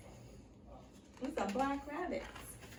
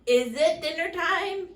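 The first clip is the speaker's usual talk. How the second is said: higher, louder bellow; 6 semitones higher, 8 dB louder than usual. A narrator's reading. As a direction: bellow across the hillside. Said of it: "he bellowed".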